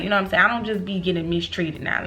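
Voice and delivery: ordinary talk, nothing marked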